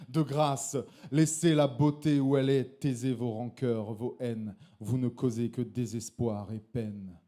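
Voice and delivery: intense slam delivery; slam poetry. Acted. Slam poet declaiming his poem to the audience. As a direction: perform the poem with conviction.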